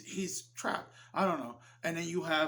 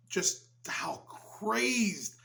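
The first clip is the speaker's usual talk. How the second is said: ordinary delivery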